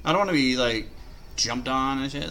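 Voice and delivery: ordinary delivery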